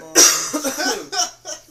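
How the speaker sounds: loud, high burst of laughter; big laugh. Real, not acted. Hearing the fight story, a loud burst of laughter. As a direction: cracks up at the story. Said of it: "That is so fucking funny".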